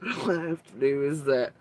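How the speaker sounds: ordinary talk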